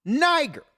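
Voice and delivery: "Niger" stated as the correct form; proper pronunciation. Shown as a demonstration. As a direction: stress the proper NY-ger sound of "Niger". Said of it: "when it's Niger".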